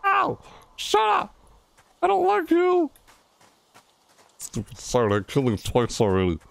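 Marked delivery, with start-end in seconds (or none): 0.0-2.9 pained angry yell. Real, not acted. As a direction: yelp then shout him off. Killed by monster, yelling.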